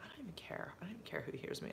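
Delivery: hushed, breathy whisper of a private thought. Retold or imitated. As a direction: whisper it to yourself, almost tearful with love. Thought recalled while holding his hand.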